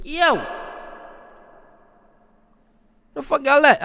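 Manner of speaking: high, loud mock yell; mock calling out. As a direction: holler for the missing crowd. Acts calling out in empty theater.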